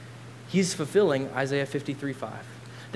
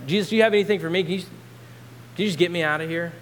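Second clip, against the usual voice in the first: raised, higher mock demand; pushy pleading; 4 semitones higher, 3 dB louder, much faster than usual. First thief asks Jesus for escape, mock pushy pleading. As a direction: wheedle loudly for a way out.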